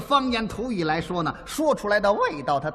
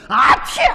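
loud fake sneeze; imitated sneeze; 2 semitones higher, 7 dB louder than usual. Comic retelling his cold to partner, loud imitated sneeze. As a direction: burst out a big comic "ah-choo". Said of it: "打一喷嚏".

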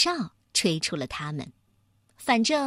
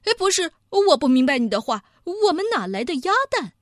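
fast, loud, higher-pitched little mouse; flustered denial; 5 semitones higher, 5 dB louder, faster than usual. A narrator's reading. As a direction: protest innocence in a rush. Mouse nervously denies having the egg.

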